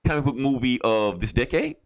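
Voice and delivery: normal talking voice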